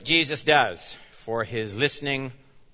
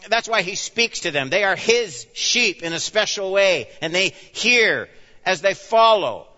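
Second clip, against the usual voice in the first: louder, higher, driving sermon voice; full-force preaching; 5 semitones higher, 6 dB louder than usual. Acted. Pastor preaching hard to his flock, loud and driving.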